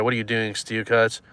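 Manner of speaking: ordinary talk, nothing marked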